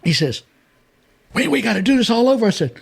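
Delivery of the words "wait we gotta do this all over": high, hurried quote of cameraman; acted-out rushed alarm, pitched up; urgent retake plea. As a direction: imitate urgently calling for a redo.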